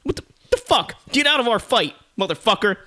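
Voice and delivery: high, loud indignant yell; angry outburst. Acted. Fighters turning on an intruder, high angry shout. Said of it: "and it's like".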